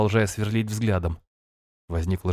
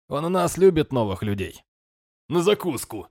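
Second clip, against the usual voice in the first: higher, gruff sailor banter; rough sailor joke; 5 semitones higher than usual. A narrator's reading. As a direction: tease the newcomer roughly.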